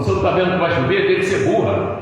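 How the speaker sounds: husband insults wife over rain, fast and loud; harsh scolding. Retold or imitated. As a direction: bark it at her, rushed and loud.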